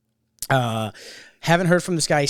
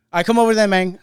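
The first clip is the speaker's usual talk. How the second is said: put-on Memphis accent; 6 semitones higher, 9 dB louder than usual. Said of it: "He got a fucking accent".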